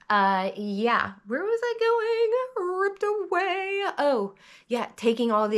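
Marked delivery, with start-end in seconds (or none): 1.3-3.8 silly high voice. Real, not acted. Losing her train of thought.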